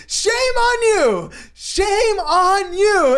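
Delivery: very high, slow, stretched yell; high drawn-out scolding shout; mock yell. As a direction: wail it like a scolding friend. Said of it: "He's like".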